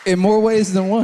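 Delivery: punchy playful interjection; emphatic aside. Real, not acted. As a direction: lift it up as a sly double meaning.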